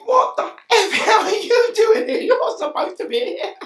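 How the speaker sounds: high, loud, laughing outrage; mock outraged yell. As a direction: bellow it at the copper through laughter. Shouting at the police driver.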